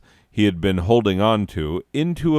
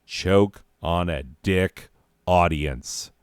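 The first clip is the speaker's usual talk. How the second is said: slow, solemn, every word weighed; deadpan slow recital; slow deliberate delivery; slower than usual. Shown as a demonstration. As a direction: read it out slowly like a pro. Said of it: "That's right. Goddamn professional".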